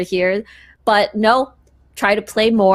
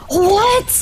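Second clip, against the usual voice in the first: surprised; 10 semitones higher than usual. Real, not acted. Caster shocked by the push.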